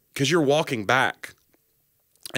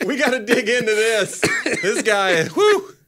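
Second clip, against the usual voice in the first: high, laughing mock urgency; rally help, comically overwhelmed; overwhelmed therapist; 9 semitones higher, slower than usual. Therapist facing a huge case, mock-urgent.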